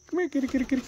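very high, quick repeated pet call; high kitty call. Real, not acted. Owner calling the cat over, high-pitched coaxing.

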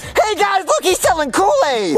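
high, drawn-out excited call; excited kid. Acted. Kid calling friends to the Kool-Aid stand, excited. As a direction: call friends over, high-pitched and eager.

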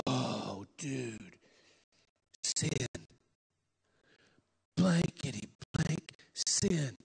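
low, slow, woozy stand-in swearing; stoned drawl. Retold or imitated.

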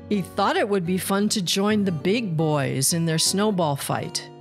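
usual speaking voice

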